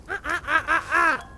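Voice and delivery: quick string of pained "ah"s; pained cries. Acted. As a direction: gasp out repeated cries over a smashed finger. Smashed my finger.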